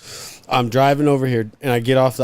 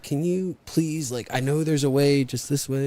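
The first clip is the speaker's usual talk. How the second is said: pleading softly; 5 dB quieter than usual. Retold or imitated. Driver softly begging the guard to let him through. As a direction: plead quietly, drawing it out. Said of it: "And I was like".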